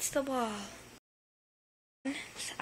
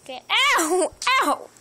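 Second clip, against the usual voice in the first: sharp loud ow of pain; real pained yelps; 6 semitones higher, 8 dB louder than usual. Real, not acted. Owner bitten by the cat, yelping in real pain. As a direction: yelp as the teeth sink in.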